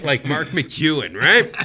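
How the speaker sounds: ordinary talk, nothing marked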